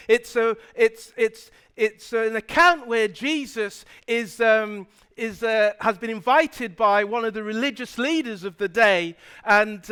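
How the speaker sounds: normal talking voice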